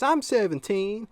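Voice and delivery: high, slow, loud insistence; imitated kid. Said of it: "He goes".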